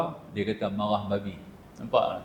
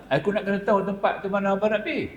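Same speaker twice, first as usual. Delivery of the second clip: put-on pitched-up, eager old man insisting on coming along; lightly voiced old man, pleading to join; Tok Long insisting; 9 semitones higher, faster than usual. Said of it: "Tok Long kata". (suara orang tua yang mendesak, nada tinggi)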